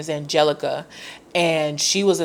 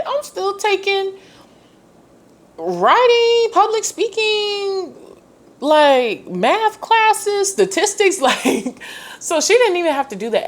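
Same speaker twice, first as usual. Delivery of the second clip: high-pitched, amused disbelief, climbing higher; incredulous rant. Real, not acted. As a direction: squeal out how absurd it was. Freshman mocking unneeded book offer.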